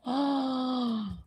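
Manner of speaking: a soft, startled intake of breath; sharp gasp. Real, not acted. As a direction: gasp in amazement at the story. Viewer shares childhood story.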